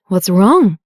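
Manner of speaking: usual speaking voice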